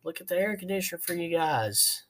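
mock yell a friendly greeting from afar; retold calling out. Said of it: "I kinda yell out".

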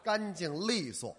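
干净利索 enunciated deliberately slowly; slow clear demo. Shown as a demonstration. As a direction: show him how to say it cleanly. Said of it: "您就别脱肛而出了".

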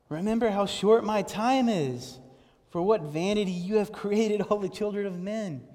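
impassioned lament. Acted. Preacher voicing the psalmist's cry to God, impassioned and loud.

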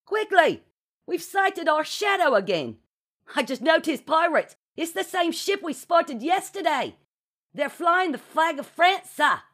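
put-on very high, strained boyish report; urgent crewman calling. A narrator's reading. Percival reports a pirate ship to captain, calling urgently. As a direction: report urgently through a closed door.

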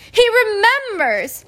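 high, loud, drawn-out delight; excited cry. A narrator's reading. Diggle thrilled that Harry remembers him, excited cry. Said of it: "cried Deadliest Diggle".

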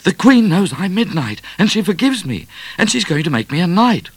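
high, loud, excited rush; panted out. A narrator's reading. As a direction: burst out with great news, speeding up. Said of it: "he panted out to Cat".

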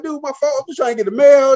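a little scared. Retold or imitated. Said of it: "I'm a little scared".

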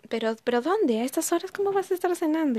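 madre sorprendida, más aguda y más fuerte, rápida; suéltalo como mamá que no se lo cree; mamá incrédula. Retold or imitated. Mamá incrédula, voz imitada. (imitando a una mamá alarmada que pregunta, más aguda y fuerte)

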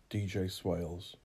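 ordinary delivery

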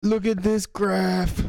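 mock awe. Mocking nerdy graphs.

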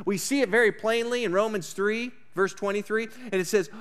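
ordinary delivery

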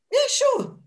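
high, eager squeaky yes; a carefree instant yes; chirpy yes. Retold or imitated. To the bar owner, a high eager yes. Accepting manager job.

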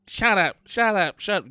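put-on baby in the back seat copying his dad; toddler parroting. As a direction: mimic a toddler gleefully repeating a bad word.

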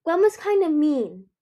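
put-on young son's lifted, eager voice; calling child. A narrator's reading. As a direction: tattle to mother in a child's voice. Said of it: "Cliff called".

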